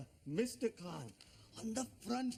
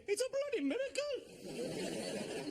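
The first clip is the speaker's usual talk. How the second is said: amazed outburst; slower than usual. Acted. Father shocked at baby news.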